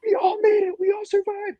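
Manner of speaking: very high, hammed-up cheer; mock Rob. Rob greeting us in the dorm, exaggerated cheery squeal. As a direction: squeal it like a smug ally. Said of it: "He was like".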